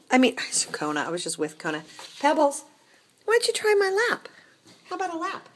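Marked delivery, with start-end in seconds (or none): 2.2-5.5 much higher, sweet invitation; coaxing pet talk. Acted. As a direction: coax the cat onto your lap. High coaxing to the cat.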